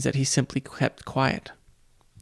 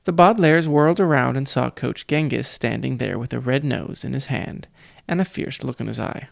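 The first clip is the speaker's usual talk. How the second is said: ordinary delivery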